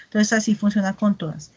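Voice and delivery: ordinary talk, nothing marked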